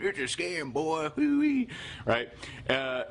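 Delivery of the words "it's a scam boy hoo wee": hammed-up mock Southern accent, higher; mock Southern accent with a high whoop at the end. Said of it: "usually in that accent".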